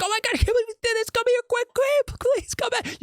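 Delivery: high, desperate screams begging for help; frantic screaming. Pretend. Imagined husband finding his murdered family, screaming frantically. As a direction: shriek it like you just found them. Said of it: "You'd be yelling and just screaming like".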